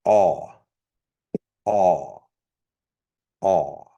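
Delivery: aw said as p'aw with a popped ejective p. Shown as a demonstration. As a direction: pop the p of aw hard. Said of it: "the popped P sound for".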